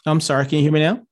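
ordinary delivery

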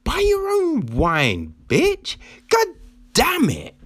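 exasperated retort, bitch jumping up high; mock-angry reply to a greedy dating profile. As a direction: snap it back like a fed-up dater. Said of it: "And be like".

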